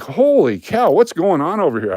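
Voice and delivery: high-pitched mock astonishment; exclaimed disbelief. Customer reacting to the bank uproar, astonished. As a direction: exclaim it like you can't believe the chaos.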